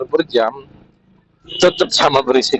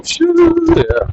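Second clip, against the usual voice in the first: high whooshing wind sound; wind whistle imitation. (tiruan suara siulan angin)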